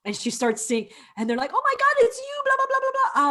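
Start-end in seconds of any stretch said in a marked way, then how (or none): 1.5-3.3 high-pitched excited gabble; mock fan gushing. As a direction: squeal like starstruck fans, trailing into blah-blah. Imitating gushing fans.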